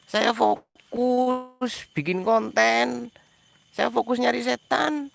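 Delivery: high, drawn-out exasperated complaint; exasperated protest. Real, not acted. Streamer defending himself to complaining viewers, exasperated protest. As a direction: stretch it out high, as if at wits' end.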